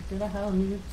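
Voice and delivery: bad Russian accent. Shown as a demonstration. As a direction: try a clumsy Russian accent. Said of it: "I'm shit at Russian accents".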